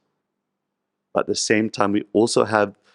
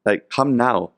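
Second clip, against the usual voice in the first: much higher, louder, pressing voice; urging Narad Muni; 6 semitones higher, 3 dB louder than usual. Retold or imitated. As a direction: push him to come now. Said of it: "So Narad Muni says".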